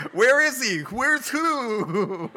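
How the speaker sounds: high, loud comic confusion; bewildered mock outcry. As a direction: cry out like someone utterly baffled. Acting out the dazed men returning.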